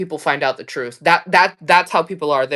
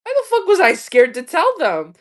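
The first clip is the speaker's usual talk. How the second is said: baffled at myself; 10 semitones higher, 4 dB louder than usual. Retold or imitated. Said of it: "I was like".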